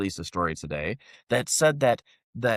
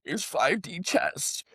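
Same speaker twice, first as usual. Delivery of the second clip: mocking supporters; 6 semitones higher than usual. Host imitating viewers' comments, high mocking voice. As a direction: parrot the excuses in a dopey high voice. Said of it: "people going".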